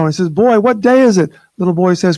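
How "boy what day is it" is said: excited call. Retold or imitated. Scrooge calling from window joyfully.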